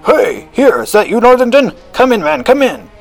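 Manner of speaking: high, loud, hearty hail; calling out. A narrator's reading. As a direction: shout a welcome down from an upstairs window. Host calls from window.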